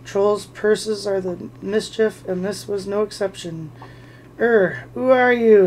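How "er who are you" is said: mock squeak, a bit louder and slow; squeaky purse. The purse to Bilbo, a light mock squeak. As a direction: let the purse squeak in alarm. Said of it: "it squeaked".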